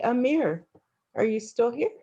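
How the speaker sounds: ordinary talk, nothing marked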